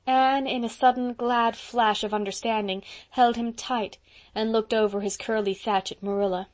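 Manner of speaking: plain reading voice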